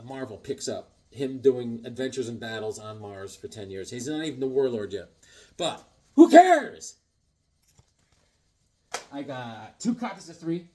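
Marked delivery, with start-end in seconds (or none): 5.6-7.0 slow, much higher, louder; exclaimed. Real, not acted. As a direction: throw it out big and slow. Exclaiming dismissively.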